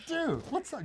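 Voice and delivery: very high, delighted greeting; excited hello. Retold or imitated. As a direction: hail a friend you did not expect. Spotting a friend at the airport.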